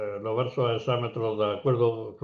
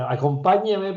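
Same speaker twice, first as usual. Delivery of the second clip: "acompáñame" lifted high and loud on its accented PA; stress on the second pa; 8 semitones higher, 6 dB louder than usual. Shown as a demonstration. Lecturer showing Rubén Darío's line to listeners, stressed PA in "acompáñame". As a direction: punch the PA in "acompáñame". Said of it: "hace el acento en".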